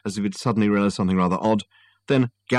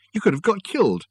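alarmed; 11 semitones higher than usual. Acted. Susan alarmed at Richard.

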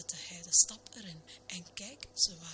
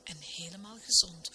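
ordinary delivery

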